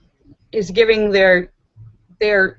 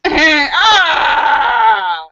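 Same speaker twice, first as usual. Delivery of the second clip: laughing shout; 6 semitones higher, 7 dB louder than usual. Real, not acted. Reacts to the joke's punchline.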